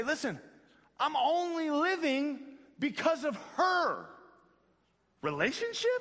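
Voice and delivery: put-on a know-it-all baby scoffing at the question; smart baby. Pretend baby in the womb answers the pastor, mock incredulous. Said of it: "Baby's gonna say".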